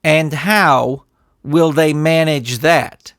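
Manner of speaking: slow, deliberate, restrained; the coach holding his temper in check. Acted. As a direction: measure out each word. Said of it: "spoke slowly".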